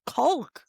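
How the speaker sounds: "caulk" said as "coalk"; baffled echo of "caulk" as "coalk". Retold or imitated. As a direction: sound the L in "caulk", long O. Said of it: "I don't say it like that".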